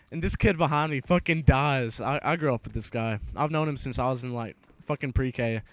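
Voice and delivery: plain reading voice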